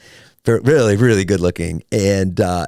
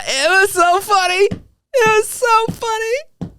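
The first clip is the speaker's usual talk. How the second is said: laughing; slower than usual. Real, not acted. Laughing recalling the joke.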